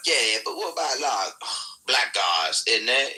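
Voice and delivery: high, mocking put-on voice of a pundit; Peter McCormick impression. Mocking a pundit's objection on a panel, high put-on voice. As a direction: imitate the pundit's rising objection. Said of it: "that sounds exactly like Peter McCormick".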